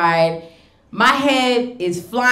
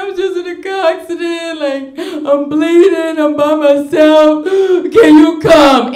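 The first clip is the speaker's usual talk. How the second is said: crying plea; 8 semitones higher, 6 dB louder than usual. Retold or imitated. Comic retelling a call to her date, high hammed-up crying plea. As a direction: beg her to come, hamming up the tears. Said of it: "And I'm crying".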